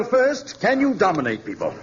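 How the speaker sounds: ordinary delivery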